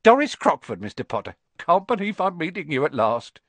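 high, loud gushing introduction; bursting to meet him; eager Doris. Acted. Doris Crockford meets Harry at last, high and eager.

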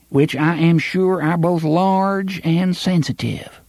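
high, wheedling small-town sheriff; oily official buttering up the boys; pompous sheriff voice. Acted. Sheriff's long speech.

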